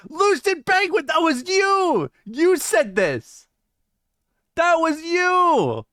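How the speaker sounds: high, slow shouted accusation, dragged out; mock accusing yell. To Lucid Penguin in chat, a slow, high mock-outraged yell. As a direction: point the finger and bellow it slowly. Accusing chatter after AI clip.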